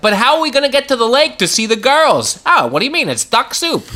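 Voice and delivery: put-on high, quick old-timey character. Host plays out another duck soup example, old-timey voice. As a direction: rattle it off like old-picture dialogue. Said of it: "In an old-timey voice".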